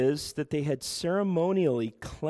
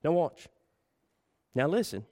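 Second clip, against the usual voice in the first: imitated Southern accent; Charles Stanley; 2 semitones higher than usual. Preacher quoting a colleague's catchphrase to the congregation, imitated Southern accent. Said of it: "he says it in his southern accent".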